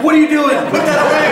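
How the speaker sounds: loud, high-pitched panicked parent; hammed-up shouted telling-off; exasperated parent yelling. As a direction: scold the kids in a comic frantic yell. Parent yelling at kids with cereal.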